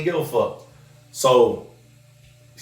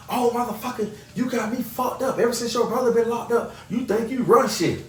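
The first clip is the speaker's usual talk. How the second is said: much higher mock tirade; imitating Trina cussing; 10 semitones higher than usual. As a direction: spit it like she's fed up. Trina cussing him out, voiced.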